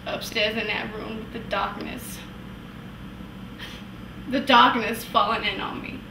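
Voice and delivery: low, soft, inward confession; haunted hush. Acted. Wife recalling a dark room, haunted hush. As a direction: sink down into a quiet, pained memory.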